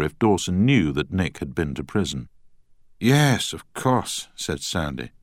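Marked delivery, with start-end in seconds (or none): 3.0-4.3 put-on Scottish accent. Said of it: "in a strong Scottish accent".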